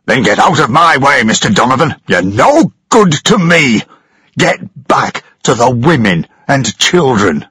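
captain angrily sending Daniel away; angry captain. Acted. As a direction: shove him off with your voice. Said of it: "pushed Daniel angrily".

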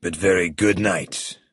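controlled, unhurried menace; menacing crime boss. A narrator's reading. Villain takes leave of captives.

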